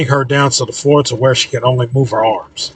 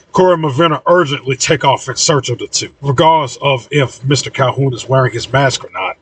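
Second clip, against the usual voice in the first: usual speaking voice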